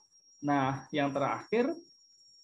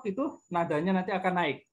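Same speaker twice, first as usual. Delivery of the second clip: normal talking voice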